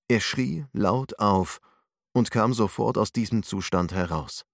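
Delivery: ordinary delivery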